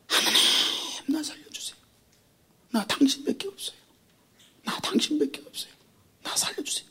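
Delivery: desperate whispered plea; whispered prayer. Acted. A believer clinging to God, a desperate whispered prayer. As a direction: whisper a prayer for your life.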